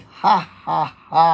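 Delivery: loud, high, separate ha's; laughing at trouble. Shown as a demonstration. Preacher laughing at each affliction named, loud deliberate laugh. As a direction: punch out a forced, defiant laugh. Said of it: "Tawanan ninyo".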